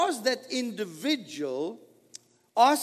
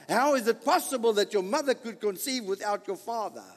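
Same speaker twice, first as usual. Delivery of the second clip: usual speaking voice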